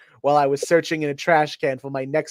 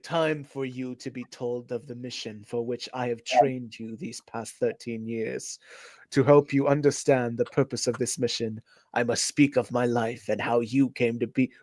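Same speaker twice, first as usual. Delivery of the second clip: put-on low wise old master; Splinter voice; 4 semitones lower, 6 dB quieter than usual. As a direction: read it as the old rat sensei. Reading Splinter's lines.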